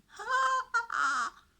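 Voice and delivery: loud mocking villain laugh; dragon's gloating laugh. A narrator's reading. Dragon laughs over the trapped knight, loud gloating laugh.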